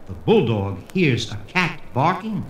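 usual speaking voice

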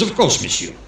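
put-on comic French-accented policeman; French accent, rising on the restaurant's name. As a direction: recommend it grandly in a French accent. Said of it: "And the policeman says".

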